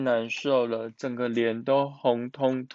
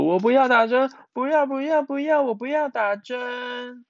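very high, acted childish wail; tearful wailing; 10 semitones higher, 4 dB louder than usual. A narrator's reading. Frightened Miaomiao refusing the injection, wailing. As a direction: keep wailing the protest, getting more frantic. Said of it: "一直哭说".

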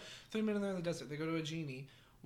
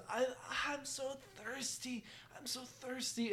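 parched, weak plea; 4 semitones higher, 7 dB quieter than usual. Acted. Thirsty man begs genie.

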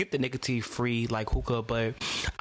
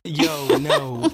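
surprised; 11 semitones higher, 7 dB louder than usual. Real, not acted. Reacting to the anti-hookah joke.

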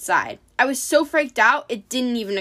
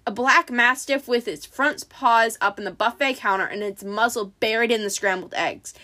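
ordinary delivery, nothing marked

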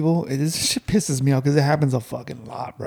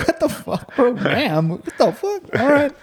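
much higher, stunned what the fuck; high indignant disbelief; mock outrage; 10 semitones higher than usual. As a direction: act baffled at being called ma'am. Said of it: "I'm like".

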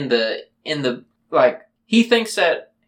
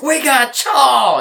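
much higher, slow, gleeful shout; excited kid quote; 10 semitones higher, 5 dB louder, slower than usual. Retold or imitated. Friend quoting the Sandlot kid, shouting gleefully. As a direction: crow it out like a proud kid. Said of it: "Sandlot when he's like".

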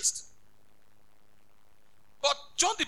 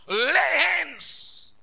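loud, drawn-out preaching cry; preacher's shouted refrain; 5 semitones higher, 5 dB louder than usual. Real, not acted. Preacher urging laying on of hands, shouted slowly. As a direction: stretch it into a big shout.

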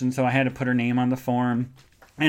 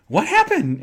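very high, louder shocked yelp; shocked what; 13 semitones higher, 5 dB louder than usual. Retold or imitated. Receptionist hearing the ring story, shocked yelp. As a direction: yelp it in disbelief.

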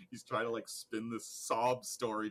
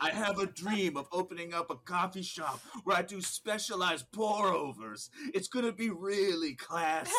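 dreamy business plan; 6 semitones higher than usual. Retold or imitated. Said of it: "starts telling him his business plan".

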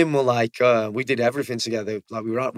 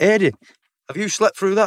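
raised, higher call to a sleeping mate; shouting at a mate who slept through the firefight; 8 semitones higher, 5 dB louder than usual. Retold or imitated. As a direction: wake him up, half laughing. Mates wake sleeping Eddie.

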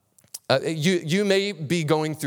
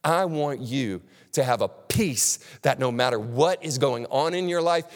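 usual speaking voice

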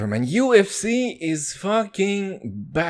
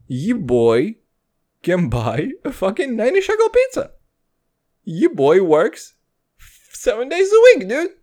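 loud, swaggering boasting; loud hype-man boast about buying pizza; hyped brag; 6 semitones higher, 5 dB louder than usual. Pretend. Hyped bragging about money.

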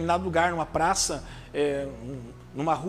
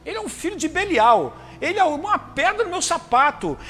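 higher, louder, exasperated ranting; resentful grumbler; 5 semitones higher, 4 dB louder than usual. Acted. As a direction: rant like a fed-up believer against his brother. Voicing a man who hates his brother. (imitando um crente irritado, mais alto e agudo, reclamando do irmão)

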